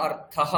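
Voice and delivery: অর্থহ low, as "artha-ha"; sounded visarga. Shown as a demonstration. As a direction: close অর্থহ with an audible short হ. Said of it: "বিসর্গের উচ্চারণ আমরা এখানে কিন্তু করব". (বিসর্গ সহ নিচু স্বরে অর্থহ)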